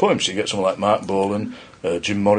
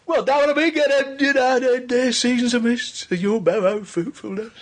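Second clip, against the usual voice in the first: Keats read in a broad EastEnders Cockney, pitched higher; 14 semitones higher, slower than usual. Retold or imitated. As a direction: recite Keats like a Londoner off EastEnders, half laughing. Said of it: "give us a bit of Keats in, in as he would have said it".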